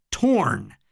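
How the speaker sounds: ordinary delivery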